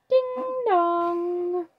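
high sing-song ding dong; doorbell imitation. Reader voicing the doorbell ringing, high sing-song chime.

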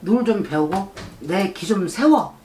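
ordinary talk

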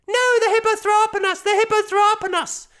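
put-on high, loud little boy protesting; child voice. A narrator's reading. Boy protesting innocence to dad, high loud child voice. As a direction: insist like a small boy caught out.

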